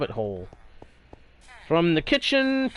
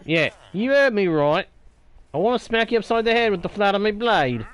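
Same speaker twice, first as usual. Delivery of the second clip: lightly put-on pirate accent, growling at the villager; a hint of a pirate accent, mostly his own voice; mock pirate accent; 5 semitones higher than usual. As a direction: play-act a pirate accent for fun. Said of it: "Sorry for the accent shift".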